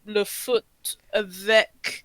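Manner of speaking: very slow, halting schoolroom French; struggling French speaker. Retold or imitated. Former pupil recalling a French speaking exam, slow and laboured. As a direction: labour through it word by word. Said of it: "The minute I was speaking, I'm like".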